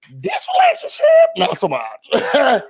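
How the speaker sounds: laughing, dismissive high tone; high mocking verdict. Real, not acted. Verdict on the relationship.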